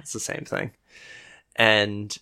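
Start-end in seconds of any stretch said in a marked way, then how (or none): 0.0-0.7 breathy whispered aside under the breath. Real, not acted. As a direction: whisper it to yourself. Muttered aside, unvoiced.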